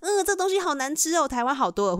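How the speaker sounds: high-pitched, picky complaint about the food; mock complaint. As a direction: turn your nose up at it, playfully.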